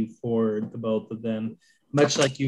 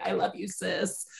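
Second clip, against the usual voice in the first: vocal fry; 6 semitones higher, 6 dB quieter than usual. Real, not acted. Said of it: "Thank you for teaching me how to vocal fry".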